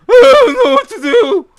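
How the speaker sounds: very high, loud squeaky put-on voice of a helpless footballer; exaggerated dithering star in a cartoonish high voice; Messi impression. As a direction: squeal it like a player who can't decide. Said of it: "That's my impression of Messi".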